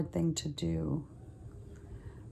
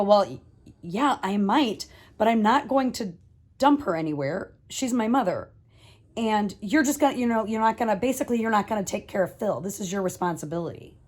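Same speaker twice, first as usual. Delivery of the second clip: heated, loud phone argument; bent out of shape; 4 semitones higher, 6 dB louder than usual. Retold or imitated. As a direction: scold them, louder and higher. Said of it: "I am bent out of shape".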